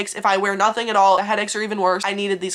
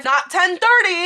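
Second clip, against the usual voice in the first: "not ten thirty" slow and high, every word weighted; emphatic correction; 8 semitones higher, 3 dB louder, slower than usual. Real, not acted. Narrator correcting the receptionist's wrong time, slow and pointed.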